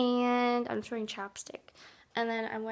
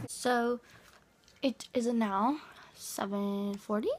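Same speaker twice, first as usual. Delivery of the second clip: ordinary talk, nothing marked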